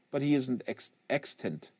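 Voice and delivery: ordinary delivery, nothing marked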